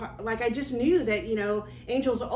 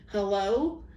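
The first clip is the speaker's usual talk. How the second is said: eerie distant hello. Retold or imitated. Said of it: "I heard it, it clear as day with my ears".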